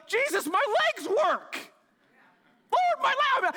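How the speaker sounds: high, slow, overjoyed cry of the healed man; imagine your legs just started working; amazed joy. Retold or imitated. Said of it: "and I'm like".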